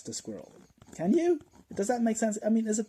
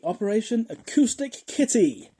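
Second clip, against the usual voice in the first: higher, much louder title reveal; grand announcement; 9 semitones higher, 9 dB louder than usual. Real, not acted. Comedian unveils absurd CIA fact, grand announcement. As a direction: announce it with loud fanfare.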